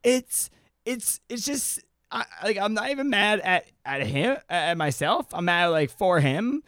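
high, flustered mock upset; imitated freaking out. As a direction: mimic her rattled, stammering protest. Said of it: "She, like, is freaking out".

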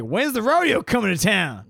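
a hint of a loud rodeo fan; high, loud cowboy. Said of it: "And now it's more like".

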